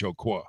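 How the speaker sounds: Ocoee said as oh-kwah like La Croix; Frenchified Ocoee. Shown as a demonstration. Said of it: "Very French".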